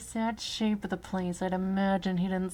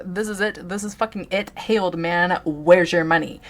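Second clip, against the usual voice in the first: much louder, rising to a yell; robber shouting; 12 dB louder than usual. Acted. Robber yells at old shopkeeper for money, building to a shout. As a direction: get angrier and louder at the old man.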